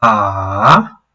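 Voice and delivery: slow "ah" dipping down then rising; third tone. Shown as a demonstration. Teacher demonstrating the dipping third tone slowly. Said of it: "this one is falling and rising".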